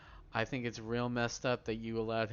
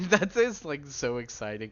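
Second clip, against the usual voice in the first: high, loud giddy excitement; excited squeal; 5 semitones higher, 10 dB louder than usual. Real, not acted. Giddy about hitman quest.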